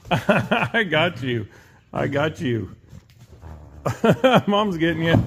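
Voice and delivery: loud playful teasing between laughs; giggly taunting of the pup; laughing tease. Real, not acted. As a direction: tease the coyote gleefully.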